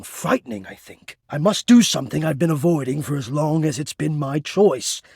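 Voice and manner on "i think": breathy whispered "I think" under the breath; whispered aside. Acted. Doubting himself aloud, a whispered afterthought. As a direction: drop to a whisper for yourself.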